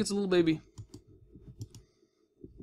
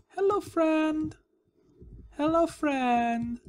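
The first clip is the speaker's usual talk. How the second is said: higher, slow drawn-out hello; sweet greeting; 9 semitones higher, much slower than usual. Acted. Greeting new villager.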